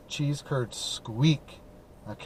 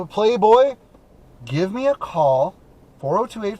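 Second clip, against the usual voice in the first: excited call-out; 6 semitones higher, 6 dB louder, slower than usual. Real, not acted.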